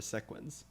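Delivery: "sequence" said SECK-wence, softly; short e pronunciation. Shown as a demonstration. Streamer trying the other pronunciation, "sequence" softly as SECK-wence. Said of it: "It's not sequence, right?".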